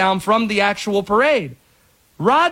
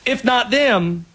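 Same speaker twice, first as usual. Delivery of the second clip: normal talking voice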